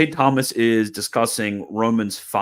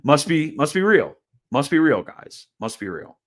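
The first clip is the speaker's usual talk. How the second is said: mocking; 6 semitones higher, 4 dB louder than usual. Mocking the editor's reasoning.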